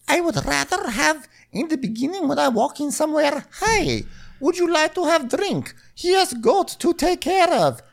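Julia. Retold or imitated. Julia walks in on talk about a date, high caricature. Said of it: "she's like".

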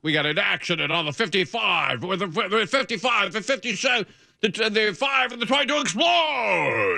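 shouted promo-style traffic report; monster truck traffic report. Pretend. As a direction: traffic report read like a rally ad. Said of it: "You should do traffic like that".

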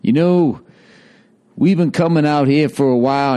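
mock rock singer addressing the crowd, slurred; mumbling singer-songwriter. Singer-songwriter chatting to a live album crowd, mumbling mockingly. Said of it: "one of those mumbling jackass singer-songwriters".

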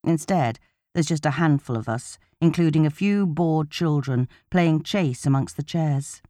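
plain reading voice

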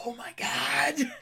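slow, breathless whisper of disbelief; whispered oh my God. Real, not acted. Complaining to a co-host about the movie, a slow whisper. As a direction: hush it like you can't believe it. Exasperated aside about the film.

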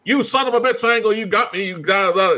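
high hammed-up outrage; mock angry Benoit. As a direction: ham up a betrayed shout, mocking. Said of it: "Benoit's like".

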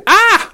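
high sharp ah. Retold or imitated.

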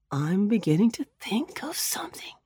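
soft whispered aside; whispering. Acted. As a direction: whisper it to yourself. Rabbit thinking aloud.

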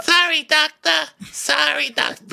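high cartoonish sorry to the doctor; mock meek apology. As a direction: squeak it like a nervous shop boy. Little Shop quote, put-on voice.

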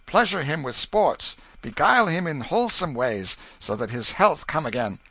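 loud, commanding royal decree; stern king. A narrator's reading. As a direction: proclaim it like a furious king. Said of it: "baleful lightnings began to play from his eyes".

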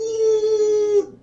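a sudden high whooping ooh; whooped ooh. Real, not acted. Preacher to congregation, sudden high whooping ooh. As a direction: let a high ooh fly out mid-sermon.

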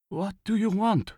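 high-pitched, wary voice; nervous old man. A narrator's reading. Old farmer to soldiers at his door, nervous. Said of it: "An elderly man nervously peered out".

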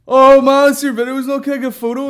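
high, loud mock fan gushing; overexcited fan. Fan spots the comic and wants a photo, overexcited. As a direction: burst in shrieking like a starstruck punter.

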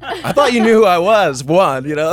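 high, loud retold indignation breaking into laughter; mock protest. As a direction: protest the slight, half laughing. Retold protest at wrong name.

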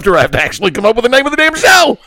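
fast, rising exasperation; exasperated protest. Real, not acted. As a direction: rattle it out, climbing high to the last word. Exasperated contestant protesting to host.

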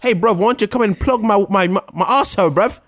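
high, hammy British bloke; mock British accent. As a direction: camp British lad voice.

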